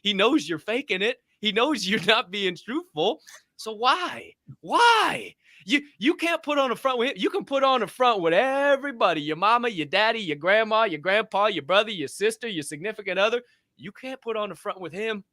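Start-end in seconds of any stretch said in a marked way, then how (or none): 3.6-11.5 high, pleading challenge; fervent, high-pitched preaching; impassioned exhortation. Real, not acted. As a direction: press the question, pitch climbing. Preaching against faking with God.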